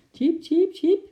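high, loud little squirrel chirps; squeaky begging peeps, pitched far up; pleading squeak. A narrator's reading. Trapped little squirrel Eki begs chimp for help, pleading high squeaks. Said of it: "piepte Eki smekend".

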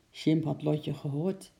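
ordinary delivery

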